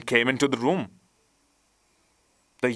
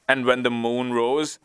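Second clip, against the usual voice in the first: plain reading voice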